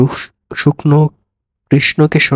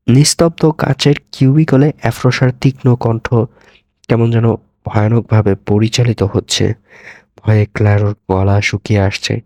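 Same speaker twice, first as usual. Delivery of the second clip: ordinary delivery, nothing marked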